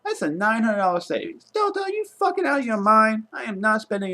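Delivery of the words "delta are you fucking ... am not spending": furious outburst. Real, not acted. Yelling at airline over price.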